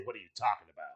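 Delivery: low, soft mock comeback; understated retold challenge; muted retort. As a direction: toss the question off under your breath. Retold argument, low weary take.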